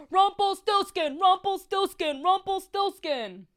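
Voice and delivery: high, loud, slow drawn-out yell of his own name; screaming the name. Retold or imitated. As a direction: scream it like a lunatic outside the door. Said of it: "he starts screaming".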